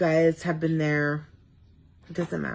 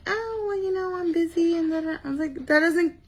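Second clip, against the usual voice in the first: high, breezy "I'm busy" excuse voice, then playful scolding; mock whiny excuse; 9 semitones higher than usual. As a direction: imitate fans brushing it off, then tease them to subscribe. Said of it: "And everybody's like".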